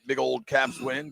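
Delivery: usual speaking voice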